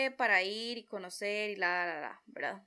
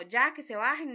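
loud, higher, impatient order; angry driver; 4 semitones higher, 7 dB louder than usual. Retold or imitated. Driver losing patience with the passengers, loud impatient order. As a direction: push them out with an annoyed shout. Driver insisting everyone get out.

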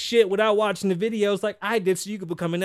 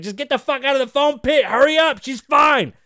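high, loud mock DJ shout; hammed-up furious DJ; DJ yelling; 8 semitones higher, 8 dB louder than usual. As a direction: scream at her to hurry out. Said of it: "the DJ's like".

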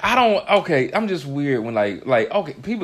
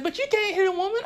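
put-on lightly raised child's voice; higher, childlike protest; daughter's voice; faster than usual. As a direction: voice a young daughter objecting. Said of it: "She said".

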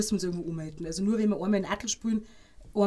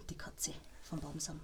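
soft whisper, tongue in cheek; whispered boast; 6 semitones lower, 18 dB quieter, slower than usual. Real, not acted. Goalkeeper whispering her proud nickname to the host after telling of her comeback. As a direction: breathe the nickname like a secret.

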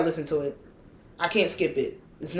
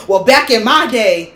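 mock old-timer; 3 semitones higher, 9 dB louder than usual. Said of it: "I really catch myself saying that shit".

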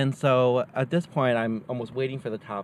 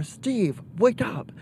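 much higher, mock urgent call up the stairs; urgent wake-up call; 10 semitones higher, much slower than usual. Mom running upstairs to wake sleeping husband, high urgent call. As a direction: yank him out of sleep with your voice.

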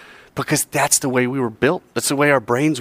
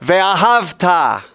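call and response; 9 semitones higher, slower than usual. Shown as a demonstration. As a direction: lead each phrase for the room to echo. Leads call and response in Hebrew.

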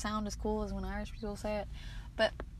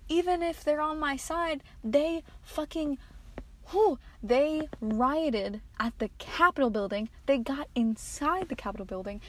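louder, higher imitation of an upset poster; mock outraged call; 3 semitones higher, 4 dB louder than usual. Vlogger imitating what patriots should have said, raised voice. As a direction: call it out as if shocked at your own side. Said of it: "instead of them being like".

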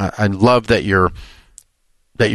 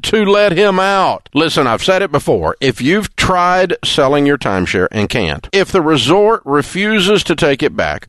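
slow, warm ad read; warm announcer; 7 semitones higher, slower than usual. Acted. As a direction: sell it like a trusted radio host. Recorded timeshare ad read.

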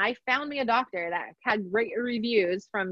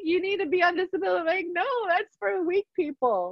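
tearful exchange; 9 semitones higher than usual. Retold or imitated. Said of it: "he's like".

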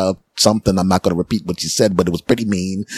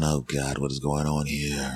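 low, very soft aside; muttered dismay; 6 semitones lower, 11 dB quieter than usual. Retold or imitated. Customer muttering to himself between the fighters, low and soft. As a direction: mutter under your breath. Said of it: "And I'm going".

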